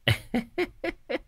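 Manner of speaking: high, soft cackling chuckle; pleased little high-pitched snicker; smug chuckle. A narrator's reading. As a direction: titter in a high put-on voice. Shaman pleased by money offer.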